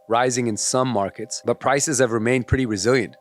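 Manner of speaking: ordinary delivery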